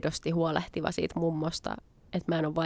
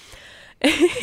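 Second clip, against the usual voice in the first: loud high delighted laugh; burst of laughter; 10 semitones higher, 10 dB louder than usual. Real, not acted. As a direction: let a happy laugh burst out. Happy ending of story.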